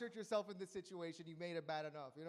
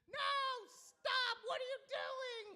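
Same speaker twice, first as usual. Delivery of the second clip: much higher, shrill panicked mock scream, slow; 3 dB louder, much slower than usual. Bystander pleading during a bar fight, mock panicked scream. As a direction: shriek like a frantic bystander at a fight. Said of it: "people start screaming".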